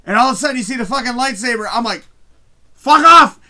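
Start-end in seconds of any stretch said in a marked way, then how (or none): 2.7-3.4 high, very loud shout of disbelief; losing it. Retold or imitated. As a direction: yell it out, thrilled and stunned. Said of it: "I lost my shit".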